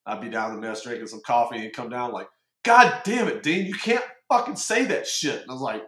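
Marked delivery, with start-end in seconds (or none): 2.6-5.4 a half-hammed, higher and louder chewing-out; mock angry yell. Retelling the CMC scolding him in the mess, a mock angry yell. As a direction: bark it like the old boss scolding you.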